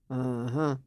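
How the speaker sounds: low, quiet, absent-minded grunt; distracted husband. Husband behind his paper brushing off his wife, distracted. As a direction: answer without really listening.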